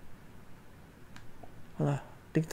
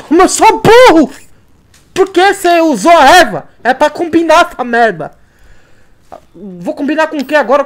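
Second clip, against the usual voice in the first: yelling at himself, high and loud; furious self-scolding; frustrated shouting; 8 semitones higher, 11 dB louder than usual. Real, not acted. Wasted herbs, scolds himself.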